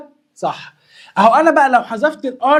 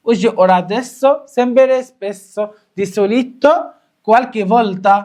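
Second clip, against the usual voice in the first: slow, stretched-out recital; slow deliberate classroom chant; slow drill; much slower than usual. Shown as a demonstration. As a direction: draw each word out for students to repeat. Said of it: "repeats list slowly".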